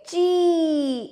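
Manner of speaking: ची with a long, held ee; long vowel sound. Shown as a demonstration. Teacher shows children the long ee sign, ची drawn out. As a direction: stretch the vowel in ची right out. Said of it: "इसका लंबा sound आता है".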